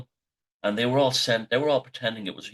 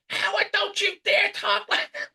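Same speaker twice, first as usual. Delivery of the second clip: very high-pitched mock scream; screechy, scolding mum impression; mother screaming. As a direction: imitate a scandalised mother screeching at the top of the range. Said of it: "and she'd be screaming".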